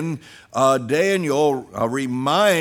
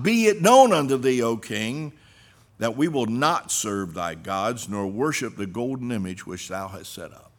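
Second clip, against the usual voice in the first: preaching at full force; 2 semitones lower, 4 dB louder than usual. Acted. Preacher repeating the youths' answer to the king, proclaiming. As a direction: build it big then let it drop.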